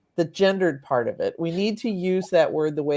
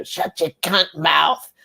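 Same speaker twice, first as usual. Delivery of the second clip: put-on Cockney accent; slower than usual. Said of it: "Cockney gutter snipe accent".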